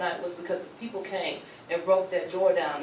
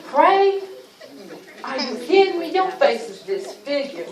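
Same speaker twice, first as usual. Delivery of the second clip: incredulous; 7 semitones higher, 8 dB louder than usual. Retold or imitated. Kids disbelieving her prayer plan.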